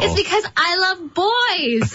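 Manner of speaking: imitating an over-keen child's answer; gleeful retold confession. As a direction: announce it proudly, stretching it out. Retelling own childhood confirmation answer.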